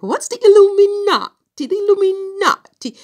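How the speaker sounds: high, loud mock Italian question; hammed-up Italian accent, squealed high and loud. Vlogger recalling her puzzlement at the Illuminati, mock Italian accent. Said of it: "he has this heavy Italian accent".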